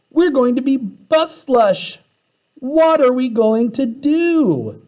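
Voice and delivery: high, slow, whining panic voice; panicked wail. Acted. Kids sucked toward the root hairs, panicked wail. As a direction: wail it out in dread.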